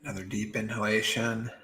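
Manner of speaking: soft, unhurried meditation voice with long pauses; guided meditation. Acted. Meditation teacher guiding beginners to drop worry, soft and calm. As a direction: ease them gently into stillness, softly.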